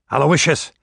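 loud call across the deck. A narrator's reading. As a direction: call his name out loud. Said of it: "it called out".

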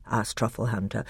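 ordinary delivery, nothing marked

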